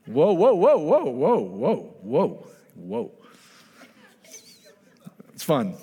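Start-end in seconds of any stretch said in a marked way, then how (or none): 0.0-3.1 mock-admiring calls that die away; playful whoa to wife. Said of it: "I do that to my wife".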